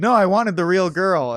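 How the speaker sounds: mock insistence. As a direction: lightly act out an exasperated boss insisting. Acted quote of a demanding leader.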